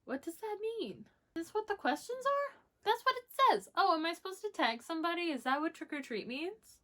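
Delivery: high, puzzled squeak; bewildered, rising pitch; confused surprise. Real, not acted. Confused by the copied questions.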